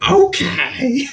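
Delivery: high, loud hype-man okay. Retold or imitated. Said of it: "Was that better or worse than my Chicago accent?".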